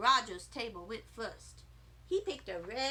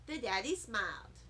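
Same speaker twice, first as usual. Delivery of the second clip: ordinary delivery, nothing marked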